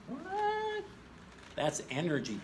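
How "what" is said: much higher single word of surprise; mock surprise. Teacher faking shock at the energy term, high. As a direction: feign astonishment.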